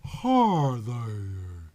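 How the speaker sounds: slow thick Southern drawl. A narrator's reading. Woman greets the detective in a thick Southern drawl. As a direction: draw it out in a Southern accent, sultry and unhurried. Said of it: "she said in her thick southern drawl".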